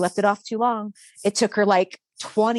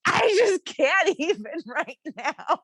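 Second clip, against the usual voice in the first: high, helpless laughing voice; howling with laughter; 6 semitones higher than usual. Retold or imitated. Mom retelling daughter getting doused, helpless laughter. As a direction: squeeze the words out through laughter. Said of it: "and I am howling".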